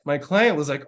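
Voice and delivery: usual speaking voice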